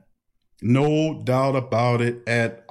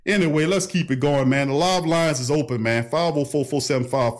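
normal talking voice

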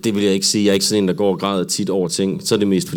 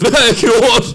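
high, loud, hammed-up crying voice; mock tearful wail; 13 semitones higher, 5 dB louder, slower than usual. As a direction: wail it like panicked fake sobbing. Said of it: "agtige tårer". (overspillet, grædende klynken)

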